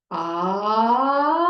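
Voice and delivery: loud ah rising up to a high note; pitch glide up. Shown as a demonstration. Therapist demonstrates glide up, loud rising ah. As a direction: glide the ah up from mid-tone. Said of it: "start at a comfortable mid-range and glide up to a higher note".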